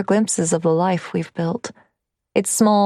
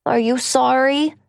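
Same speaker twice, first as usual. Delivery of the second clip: put-on child asking; 7 semitones higher than usual. Boy asking mother.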